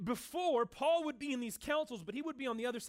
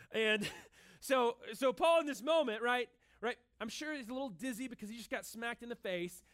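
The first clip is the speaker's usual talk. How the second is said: usual speaking voice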